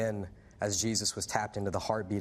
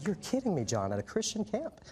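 incredulous surprise; 11 semitones higher than usual. Retold or imitated. Teen telling his friend John he can't believe it, surprised. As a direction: pitch jumps way up in disbelief. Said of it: "I said".

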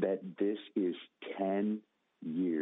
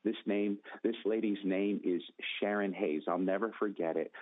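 ordinary talk, nothing marked

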